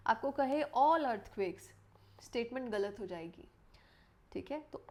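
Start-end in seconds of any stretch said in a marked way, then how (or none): 0.6-1.6 "all" punched to flag the overbroad claim; stress on all. Shown as a demonstration. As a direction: lean hard and slowly on "all". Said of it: "दो words पे stress देना है".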